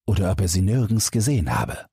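usual speaking voice

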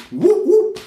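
swaggering show-off squeal; cocky newcomer. Retold or imitated. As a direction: hype yourself up like a club kid. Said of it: "dass die erstmal sind so".

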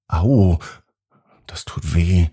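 hurt, whispered ouch; pained whisper. A narrator's reading. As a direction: wince and whisper it. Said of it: "hat sie erneut gesagt".

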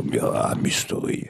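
breathy low mystery whisper; eerie announcer hush; spooky trailer voice. Shown as a demonstration. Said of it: "du musst auch so eine Stimme aufsetzen".

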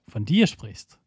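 ordinary talk

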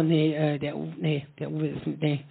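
hushed, bewildered stammering; mock nervous denial, voice low and quiet; flustered stammer. Flustered wife stammers on stage.

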